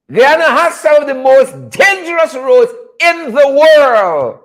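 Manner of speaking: emphatic warning about the roads; emphatic declaration. Real, not acted. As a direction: hammer the claim home slowly.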